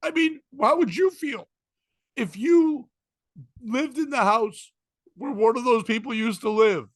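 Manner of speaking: mock-offended question, drawn out; indignant woman. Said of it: "And then she goes".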